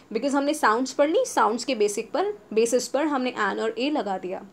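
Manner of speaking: ordinary talk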